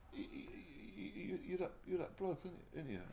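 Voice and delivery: put-on high, stammering awestruck kid. Lightly voiced kid stopping him in the street, stammering. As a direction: mimic the starstruck boy stuttering.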